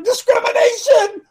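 high, slow shouted "Discrimination!"; mock outraged yell. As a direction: shriek it like an offended protester. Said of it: "we would yell".

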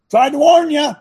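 loud, high-pitched mock condolence; mock call-out. Host voicing a callous reply to the bereaved, mock call-out.

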